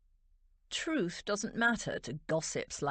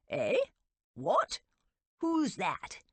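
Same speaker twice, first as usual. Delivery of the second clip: puzzled lord; 5 semitones higher, 3 dB louder, slower than usual. A narrator's reading. Towsett peering into the gloom.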